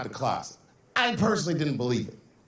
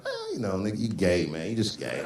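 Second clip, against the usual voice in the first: distracted mumble; 6 semitones lower than usual. Comic half-listening to his friend on the phone, mumbling. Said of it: "mumbling shit throughout this conversation".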